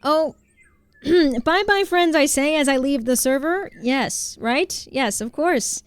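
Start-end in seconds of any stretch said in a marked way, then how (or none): 1.4-3.7 high, loud cheery goodbye; loud, chirpy sign-off; chirpy farewell. Pretend. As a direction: wave everyone off brightly. Mock farewell leaving server.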